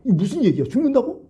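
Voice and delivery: retold shocked, heated questioning; indignant disbelief. Peter hearing that Jesus will die, indignant disbelief. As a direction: pitch leaping up in disbelief. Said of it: "베드로가 탁 그냥 뚜껑 열려버린".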